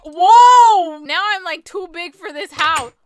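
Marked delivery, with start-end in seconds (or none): 0.1-1.0 loud, drawn-out exclamation; slow, booming burst of surprise; startled whoa. Real, not acted. Griffin player suddenly growing up, long startled whoa.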